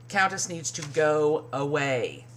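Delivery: ordinary talk, nothing marked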